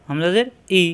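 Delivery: normal talking voice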